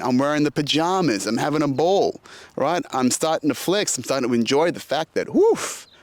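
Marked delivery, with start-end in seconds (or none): 5.3-5.9 a sudden high, delighted "woof"; excited woof. Retold or imitated. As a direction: let it burst out high like you've won. Boasting about having made it.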